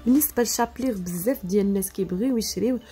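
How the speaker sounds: ordinary talk, nothing marked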